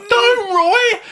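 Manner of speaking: very high-pitched, loud mock girly scream; screamed like a girl. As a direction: shriek a panicked warning in hammed-up falsetto. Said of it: "you screamed like a girl".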